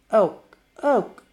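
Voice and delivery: lightly voiced excited kid; eager kid voice. Gina character voice.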